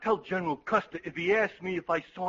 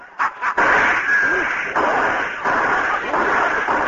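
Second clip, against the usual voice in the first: long loud cackling laugh; 7 semitones higher, 5 dB louder than usual. Acted. Aide laughs hard.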